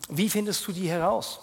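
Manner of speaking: ordinary delivery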